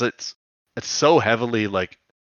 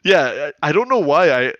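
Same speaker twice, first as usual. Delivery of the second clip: ordinary talk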